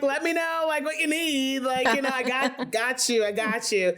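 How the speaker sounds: high-pitched, overeager offer of help; mock eager reassurance. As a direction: babble reassurance while clearly out of your depth. Acts out clueless new boss offering help.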